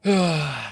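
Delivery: loud falling sigh; heavy sigh. Real, not acted. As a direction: heave a big, loud sigh. Sighs while settling in.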